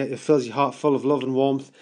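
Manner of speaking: normal talking voice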